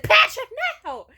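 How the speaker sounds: high, loud scream of protest. Retold or imitated. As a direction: scream at him to stop, re-enacted. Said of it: "I started screaming at him".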